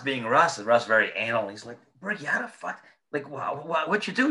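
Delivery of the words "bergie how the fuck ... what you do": a high, flustered boss demanding answers; exasperated mock scolding. Retelling Russ grilling Bergie over the wine, high exasperated mock scold. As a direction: pitch jumps high in disbelief, stammering.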